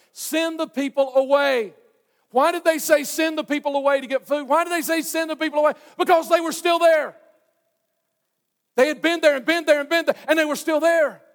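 high, loud, insistent repetition; full-force preaching. Real, not acted. Preacher asking why disciples would send people away, full force. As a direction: hammer it out to the crowd.

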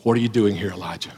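hushed, soft voice of God; still small voice. Acted. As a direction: speak it gently, barely above a breath. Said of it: "the still small voice said to him".

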